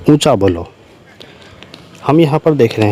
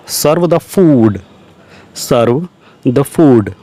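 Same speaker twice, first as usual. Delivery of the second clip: slow dictation; much slower than usual. Shown as a demonstration. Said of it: "teacher repeats phrase for learners".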